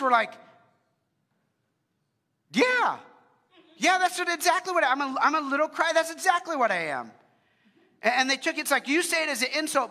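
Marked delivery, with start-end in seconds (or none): 2.5-7.1 high, loud proud declaration; proud shout. Retold or imitated. Christians answering their mockers with a loud proud shout. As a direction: shout it back like a badge of honor.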